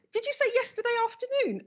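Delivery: can't believe the timing; astonished. Retold or imitated. Healer checking when it happened, astonished. Her own astonished reply.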